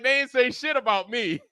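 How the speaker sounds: high, giggling retort; gleeful high-pitched mock boast; laughing quote. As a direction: laugh through the brag. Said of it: "I was like".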